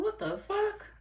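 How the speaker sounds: high, laughing mock disbelief; shocked. Retelling her shock at someone's comment, high and laughing. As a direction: throw it up high like you can't believe it. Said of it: "I'm like".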